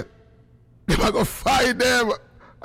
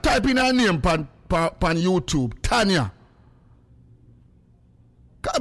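ordinary delivery

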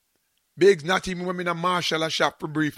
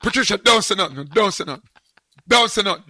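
louder, pressing don't say nothing; urgent loud hush; 3 semitones higher, 7 dB louder than usual. Retold or imitated. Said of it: "I'm saying".

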